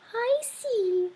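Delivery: little mouse realizing something; sighing mouse. A narrator's reading. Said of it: "sighed Mortimer".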